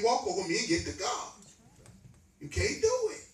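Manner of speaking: hammering the message with rising fervour; preaching at full force. Acted. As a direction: drive the sermon home to the pews.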